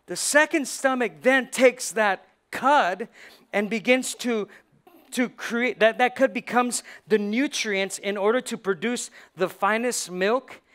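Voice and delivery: loud, rising preacher's build-up; preaching. Acted. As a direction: preach it to the congregation with fire. Preaches about the cud.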